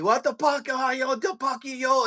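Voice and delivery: high, garbled mock Filipino rant; screaming Filipino instructor. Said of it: "screaming at me".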